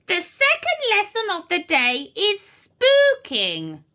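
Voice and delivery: high, theatrical witch teacher; announcing witch teacher. A narrator's reading. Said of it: "announced Miss Spell".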